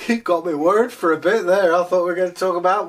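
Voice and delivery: laughing relief, high and quick; real laughter bubbling through. Real, not acted.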